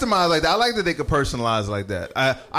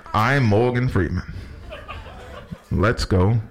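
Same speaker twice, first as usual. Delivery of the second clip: low, slow, deep narrator voice with laughs; Morgan Freeman; 6 semitones lower than usual. Retold or imitated. GPS app in Morgan Freeman's voice, deep and slow. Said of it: "He was like".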